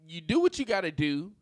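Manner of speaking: ordinary delivery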